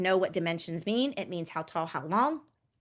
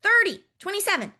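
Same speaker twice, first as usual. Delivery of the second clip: eager guessing; 11 semitones higher, 6 dB louder than usual. Retold or imitated. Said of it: "what do you think the next number".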